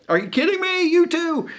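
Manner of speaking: hammed-up outraged squeal; incredulous high Pharisee voice; exasperated Pharisees. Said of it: "the Pharisees are like".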